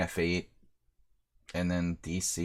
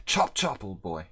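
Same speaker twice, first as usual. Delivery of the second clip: put-on British accent. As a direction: put on a hammed-up British gent. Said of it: "Love this new British accent thing".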